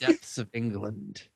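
put-on a deliberately poor English accent, said grandly; hammed-up fake English accent. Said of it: "with my English accent".

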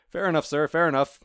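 cat giving in to Death; cat conceding. Acted. Cat voiced answering Death.

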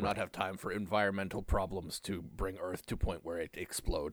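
low, broken caveman speech as Bizarro. Pretend. Speaks as Bizarro in broken English.

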